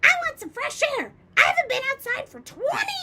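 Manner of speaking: high, loud, fast crone's cry; excited shout. A narrator's reading. Grandma to George, shouting for fresh air. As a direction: rattle it out wildly. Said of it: "she shouted".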